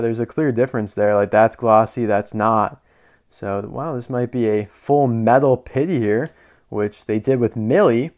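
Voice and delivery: normal talking voice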